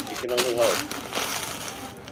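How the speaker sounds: very slow, drawn-out hope; slow wistful. Acted. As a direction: draw it out mournfully.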